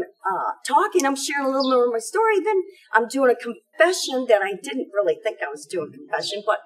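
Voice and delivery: ordinary talk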